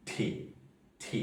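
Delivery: ঠি, ঠি with a hard puff each time; aspirated t. Shown as a demonstration. Teacher models the aspirated t twice, puffing. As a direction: give two airy bursts on ঠি. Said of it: "যেমন".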